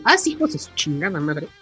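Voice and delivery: ordinary talk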